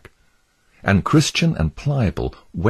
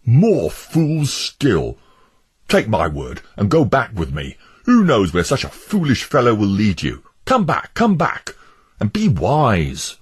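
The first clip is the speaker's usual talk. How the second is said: exasperated Obstinate; 5 semitones higher, 3 dB louder than usual. Acted. Obstinate urging Pliable to come back, exasperated. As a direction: call him back firmly.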